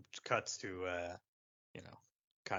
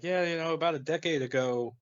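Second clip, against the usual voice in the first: higher, louder voice, playing baffled; mock puzzled recounting; 5 semitones higher, 4 dB louder than usual. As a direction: lightly act out a bewildered storyteller. Acts out puzzled Luke about ghost.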